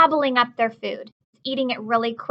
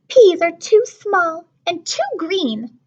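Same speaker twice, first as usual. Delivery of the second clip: put-on higher, slow, picky little girl voice; fussy little sister; 6 semitones higher, 5 dB louder, slower than usual. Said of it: "Lola says".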